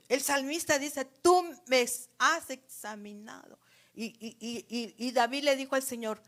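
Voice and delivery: loud, fervent preaching; urgent pulpit voice calling the church to examine itself. Acted. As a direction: drive the questions home to the church. Fiery preaching.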